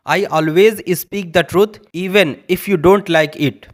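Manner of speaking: very slow, word by word English; careful classroom reading; slow dictation. Shown as a demonstration. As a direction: spell it out slowly for learners. Teacher dictating English example.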